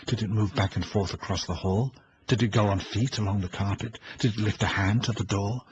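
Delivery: usual speaking voice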